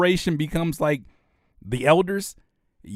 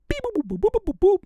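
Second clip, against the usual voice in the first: robot beeps; faster than usual. Retold or imitated. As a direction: chirp like a machine. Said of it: "it's gonna be like".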